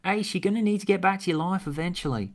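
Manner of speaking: put-on Australian accent, then Ace's reply. Script dialogue, Tegan and Ace.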